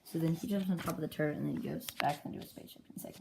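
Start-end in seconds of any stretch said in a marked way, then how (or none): none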